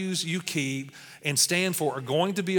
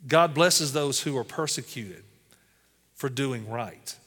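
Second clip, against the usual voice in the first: slow scripture reading; 3 semitones lower, slower than usual. Acted. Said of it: "Listen to Matthew five ten".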